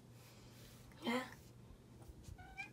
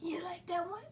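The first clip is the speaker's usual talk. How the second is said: higher soft whisper; whisper to the pet; 5 semitones higher, 5 dB quieter than usual. Real, not acted. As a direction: whisper gently to the dog.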